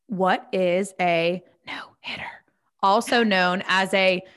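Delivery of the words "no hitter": whispered question. Shown as a demonstration. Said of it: "I'm gonna whisper".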